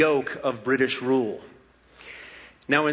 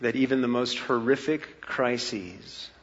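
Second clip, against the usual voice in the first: prayer; 4 semitones lower, 5 dB quieter than usual. Acted. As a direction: pray quietly and earnestly. Pastoral prayer.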